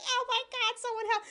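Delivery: hammed-up plea for rescue; mock panic. Woman chased by a roach, mock panic.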